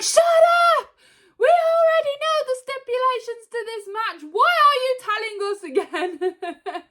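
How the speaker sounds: high put-on voice, snapping; high mock voice, exasperated; mock Seth outburst. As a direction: cut in like Seth, fed up. Seth cutting in, retold.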